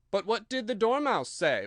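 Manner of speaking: a juror asking eagerly; curious juror. A narrator's reading. Juror asks.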